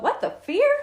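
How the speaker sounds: high, loud rising announcement; hammy game-show host delivery; game-show title call. Pretend. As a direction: sing out the show's name as a question. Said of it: "Do you have to say it like that?".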